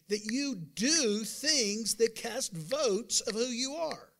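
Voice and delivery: slow emphatic reading. Real, not acted. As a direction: drag it out for weight. Preacher stretches scripture reading.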